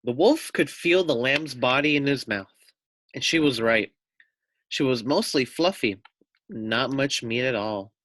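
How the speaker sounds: storybook narration. A narrator's reading. Teacher reading a picture book to children, animated narration. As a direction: read it out to the children like a bedtime story.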